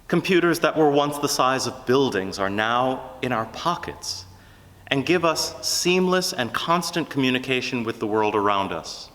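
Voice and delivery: normal talking voice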